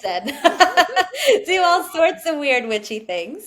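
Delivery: laughing through the words, slow and high. Real, not acted. Laughing about witchy childhood.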